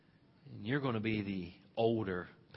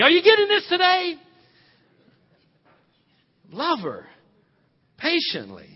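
loud, high preacher's call; rally the whole church; preaching at full force; 6 semitones higher, 14 dB louder than usual. Real, not acted. Preaching to the congregation.

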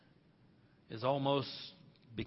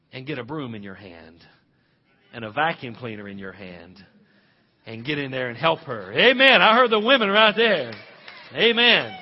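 loud, high preacher's call; rally the whole church; preaching at full force; 6 semitones higher, 14 dB louder than usual. Real, not acted. Preaching to the congregation.

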